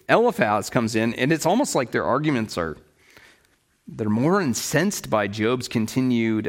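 ordinary talk, nothing marked

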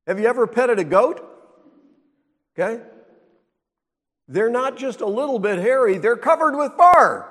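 emphatic. Real, not acted. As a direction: drive the point home loudly. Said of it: "emphatic preaching about goat fur".

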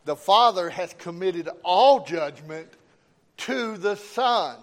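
loud, emphatic sermon delivery; forceful preaching. Real, not acted. Preacher reads scripture and exhorts the congregation, forceful preaching. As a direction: preach it out, punching key words.

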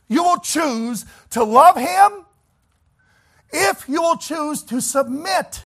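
fervent preacher's climactic shout; preaching climax. Acted. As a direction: cry it out at the peak of the sermon.

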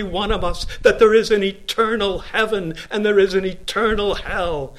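booming revival preaching; full-force preaching. Acted. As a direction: thunder it from the pulpit.

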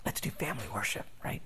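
soft, quick, near-whispered nudge; a quick hushed reminder to her husband; gently reminding him under her breath; gentle reminder. Retold or imitated. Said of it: "needs that gentle reminder from his helper".

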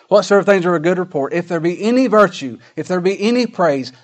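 loud, ringing scripture recitation, pitched high; preaching scripture. Acted. Preacher quoting the verse to his congregation, emphatic scripture reading. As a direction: drive through the list, lifting each item.

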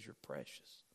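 mean it gently, from the heart; God speaking to His child, tender and sincere. Pretend. God's sincere words contrasted.

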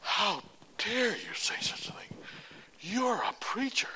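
put-on high, slow scandalized old man; high, slow, prim mock indignation; mock outrage. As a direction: play the shocked friend, clutching pearls. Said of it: "he just looked at me like".

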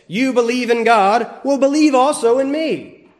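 first half a fact, second half a firm command; statement then command. Shown as a demonstration. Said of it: "Or it could be one and one, a mix".

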